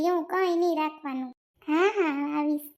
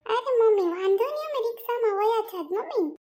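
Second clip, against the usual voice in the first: put-on young bride reassuring her mother-in-law, higher and sweeter; polite young daughter-in-law; 6 semitones higher than usual. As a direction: play the eager young bride insisting politely. Daughter-in-law voiced, pleading politely.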